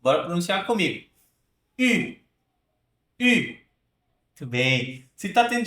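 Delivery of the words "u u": higher-pitched U with a tight pout; U as a front rounded French vowel; French U, lips puckered. Shown as a demonstration. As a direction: pucker and model U clearly. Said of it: "prepara o seu bico aí".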